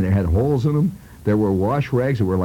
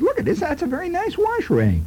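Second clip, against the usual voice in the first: lightly voiced mother, higher pitch; admiring mother. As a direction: coo over it in a hint of mom's voice.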